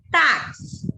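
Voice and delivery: "Táxi" loud with a strong TÁ, falling away; emphatic demo, weight on the first syllable of "Táxi"; stressed first syllable. Shown as a demonstration. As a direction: punch TÁ in "Táxi". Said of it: "é aqui, ó".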